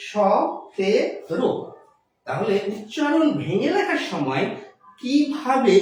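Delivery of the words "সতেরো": সতেরো very slow, syllable by syllable, with sh; palatal sh. Shown as a demonstration. Teacher spelling out seventeen's pronunciation for students, palatal sh. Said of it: "তালব্য স দিয়ে".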